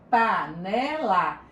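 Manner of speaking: slow, syllables pulled apart. Shown as a demonstration. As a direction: spell out Panela slowly, pa-ne-la. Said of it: "Que análise silábica?". (Panela bem devagar, sílaba por sílaba)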